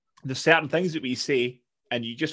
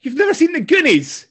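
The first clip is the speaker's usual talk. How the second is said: much higher, much louder incredulous outburst; shocked disbelief; 12 dB louder than usual. Real, not acted. To a friend who never saw The Goonies, shocked loud disbelief. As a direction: blurt it out in total disbelief, hitting never.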